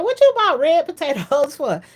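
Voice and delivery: high, loud retold challenge; incredulous friend. Friend questioning red potatoes for fries, mock incredulous. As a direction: imitate a friend's disbelief.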